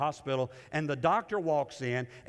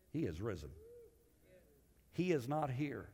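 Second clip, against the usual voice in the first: hushed first reading; 8 dB quieter than usual. Shown as a demonstration. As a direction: read it quietly, almost to yourself. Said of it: "I can imagine".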